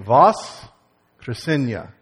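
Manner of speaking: Voskresenia called out loud and very slowly; loud slow unison. Shown as a demonstration. Pastor has the whole congregation repeat the Russian word, loud and slow. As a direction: lead the crowd through Voskresenia, loud and slow. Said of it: "Say it again".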